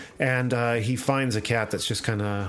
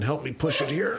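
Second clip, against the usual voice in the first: mock strain; 6 semitones higher than usual. Pretending to push vault door.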